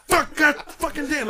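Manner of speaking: high, loud, strained mock cursing; hammed-up agonized swearing; straining man's cursing. Imitating a stranger straining on the toilet, agonized cursing. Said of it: "he's like".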